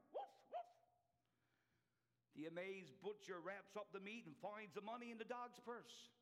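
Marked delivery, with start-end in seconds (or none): 0.1-0.9 high playful woof woof; hammed-up dog barking; dog barks. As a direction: bark like a friendly dog. Dog answers the butcher.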